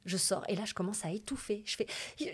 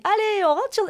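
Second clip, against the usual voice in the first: much higher, louder, chirpy call; mock cheerful call; 11 dB louder than usual. Theatre colleagues calling her onstage, mock chirpy call. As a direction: imitate the jolly stage mates hurrying her on.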